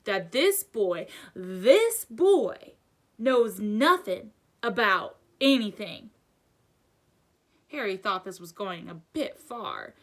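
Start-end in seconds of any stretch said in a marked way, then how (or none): 0.1-6.1 loud, slow, hammered-out outrage; drawn-out, incredulous anger; angry growl. A narrator's reading. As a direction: hit each key word hard. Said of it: "he growled at the Dursleys".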